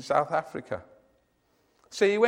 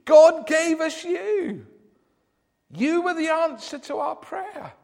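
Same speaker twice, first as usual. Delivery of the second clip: put-on high, slow, wondering old woman's voice; high old lady in awe; amazed old lady; 11 semitones higher, 6 dB louder, slower than usual. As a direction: marvel slowly like a grateful old lady. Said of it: "said one old lady".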